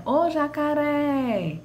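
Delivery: high, slow call of "Ô, jacaré", long on "ré"; "jacaré" sung out with a held last syllable; calling out stretched. Shown as a demonstration. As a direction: call "jacaré" like a name, dragging the final "ré". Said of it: "quando eu chamo a palavra".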